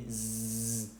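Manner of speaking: long, soft zzz; wasp-like Z buzz. Shown as a demonstration. Teacher imitates a wasp to show Z, buzzing. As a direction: sustain a low voiced buzz like a wasp. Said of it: "como una avispa".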